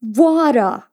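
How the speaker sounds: "Water" with an American tapped t; American accent. Retold or imitated. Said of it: "Y like". (acento americano en "Water")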